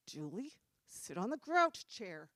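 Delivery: slow, restrained motherly anger; imitating gritted-teeth anger. Mom sends child to grouch chair, tightly restrained anger. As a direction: force it slowly through gritted teeth. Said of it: "grits her teeth when she's mad".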